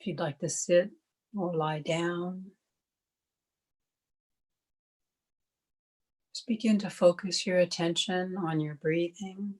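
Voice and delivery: calm, even meditation voice with long pauses; guided meditation. Acted. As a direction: guide listeners gently into stillness, one unhurried phrase at a time.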